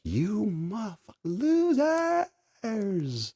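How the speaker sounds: high, laughing taunt; mock laughing taunt. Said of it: "I'm over here laughing hysterically".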